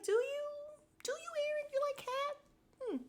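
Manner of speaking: very high squeaky put-on voice; mock cooing. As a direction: coo it in a tiny, teasing high pitch. Mock cooing reply to quoted Eric.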